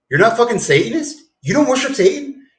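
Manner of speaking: mocking disbelief. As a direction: needle him, voice up high. Cardinal mocking the Swiss Guard.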